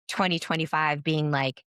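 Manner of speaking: ordinary delivery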